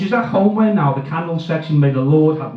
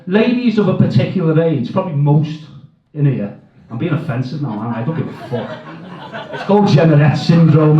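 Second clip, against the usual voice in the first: ordinary talk, nothing marked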